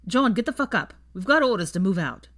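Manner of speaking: urgent barracks yell; shouted wake-up order. A narrator's reading. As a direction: rouse him with a loud command. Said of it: "he shouted".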